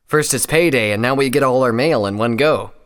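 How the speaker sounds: loud, pleased announcement; cheerful, grinning. A narrator's reading. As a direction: say it through a grin. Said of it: "Headrap said with a grin".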